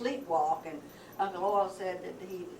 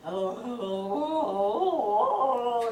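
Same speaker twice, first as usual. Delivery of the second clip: drawn-out eerie "oh" moans, swelling; weird moaning noise; 4 semitones higher, 5 dB louder, much slower than usual. Retold or imitated. Retelling the noise heard at the pigpen, weird moaning. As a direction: moan like a strange noise in the dark. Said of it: "That was a weird noise".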